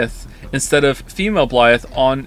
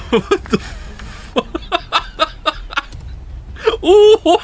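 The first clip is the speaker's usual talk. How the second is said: laughing; 13 semitones higher, much slower than usual. Real, not acted. Reacting to fan art.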